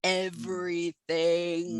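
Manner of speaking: slow, low creepy voice. Acted. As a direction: drag the word out eerily. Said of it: "that was my creepy voice".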